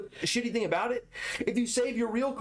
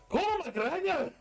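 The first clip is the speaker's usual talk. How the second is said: high, slow fake Scottish with rolling R's; stretched-out Scottish call-out, trilled R's; mock Scottish, rolled R's; 12 semitones higher, slower than usual. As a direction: roll the R's in a hammy Scottish accent. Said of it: "He, like, rolled his R's".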